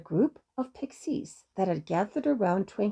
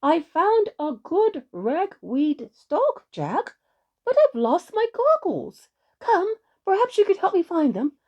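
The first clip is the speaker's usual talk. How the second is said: high, loud witch calling out; jolly little witch; 8 semitones higher, 5 dB louder than usual. A narrator's reading. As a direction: call across a field in a high, lively witch voice. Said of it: "the Jolly Little Witch called out".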